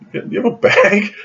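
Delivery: high-pitched retold asking; sheepish request. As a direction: re-enact an awkward little favour.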